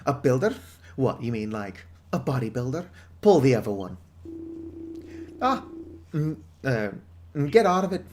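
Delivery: gruff British pub owner, lightly voiced; British publican. Pub owner warning the outsider off, British accent. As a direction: warn off the outsider like an old barkeep.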